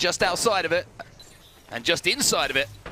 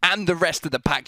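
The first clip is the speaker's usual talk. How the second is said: raised, driving match-winning call; victory play-by-play; 7 semitones higher, 4 dB louder than usual. Real, not acted. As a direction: hail the winners with big commentary energy.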